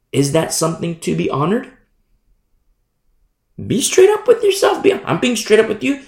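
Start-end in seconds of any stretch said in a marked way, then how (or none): 3.7-6.0 high, loud preaching push; forceful exhortation. Real, not acted. Preacher urging the listener to face himself, loud and high. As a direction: drive it home to the listener.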